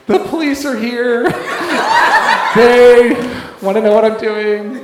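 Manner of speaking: retelling through real laughter; laughing. Retold or imitated. Telling the church about the police, laughing. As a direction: laugh your way through the line. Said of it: "I'm, like, laughing, crying".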